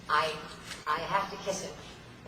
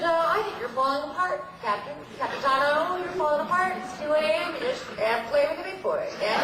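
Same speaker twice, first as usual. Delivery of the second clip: high, louder teasing voice; mimicking co-star; 11 semitones higher, 5 dB louder than usual. Co-star teasing the captain at two AM: high mocking taunt. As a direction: taunt her like a cocky co-star, voice pitched up. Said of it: "He said".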